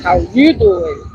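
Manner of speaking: badly faked accent with a drawn-out YOU; slow, low catchphrase in an attempted accent. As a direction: try an accent, lean on YOU. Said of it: "I can't do an accent".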